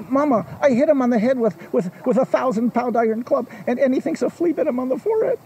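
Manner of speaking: high, fast incredulous wail; can't believe he survived; astonished dragon. Acted. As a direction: dragon stunned, telling his mother. Dragon bewildered to mother.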